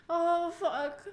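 sudden loud outcry; loud cry. Real, not acted. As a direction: let it burst out loud.

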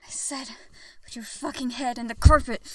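building to a loud order; stern command. Acted. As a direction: repeat it forcefully, losing patience. Repeats an ignored order.

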